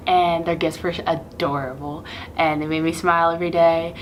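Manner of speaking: ordinary talk, nothing marked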